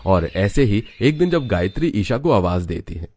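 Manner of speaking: ordinary delivery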